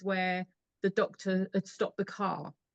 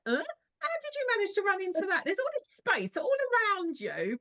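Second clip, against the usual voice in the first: mock bewildered question, much higher; incredulous, pitched way up; baffled disbelief; 11 semitones higher, faster than usual. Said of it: "you're like".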